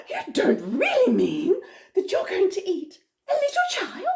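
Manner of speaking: high, incredulous storybook question; horrified disbelief. Acted. Humpy Rumpy horrified by crocodile's plan.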